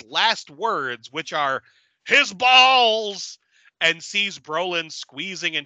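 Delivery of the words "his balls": high, loud hammed-up yell; mock shout. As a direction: blurt it out like a dying gasp. Retelling a dying man's last words.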